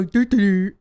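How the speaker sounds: loud, goofy "do-do" babble; mocking nonsense noises. Daughter mocking people who ask about tall dad, loud babble. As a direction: blurt dumb syllables in place of words. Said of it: "they may as well just go".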